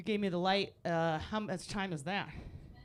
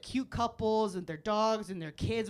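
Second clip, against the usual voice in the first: ordinary talk, nothing marked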